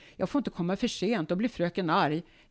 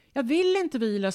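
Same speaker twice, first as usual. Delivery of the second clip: stubborn refusal; 7 semitones higher, 5 dB louder than usual. Retold or imitated. Mother refusing to rest.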